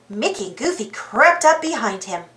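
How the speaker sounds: ordinary delivery, nothing marked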